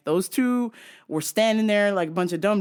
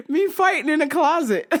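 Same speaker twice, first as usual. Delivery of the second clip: laughing; 9 semitones higher than usual. Real, not acted. Laughing, higher.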